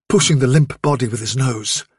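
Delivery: usual speaking voice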